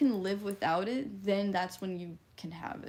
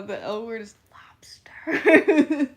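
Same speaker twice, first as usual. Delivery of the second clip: slow, breathy laughing whisper; 2 semitones higher, 14 dB louder, slower than usual. Real, not acted. Telling chat the secret word in a laughing whisper. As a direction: reveal it in a giggling hush.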